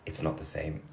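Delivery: low, quiet deadpan; soft, low, blunt reply. Retold or imitated. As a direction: say it with a dead face. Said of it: "like, dead face".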